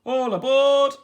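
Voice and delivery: high, loud hearty call; captain rallying the crew; calling all aboard. A narrator's reading. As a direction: bellow it across the deck.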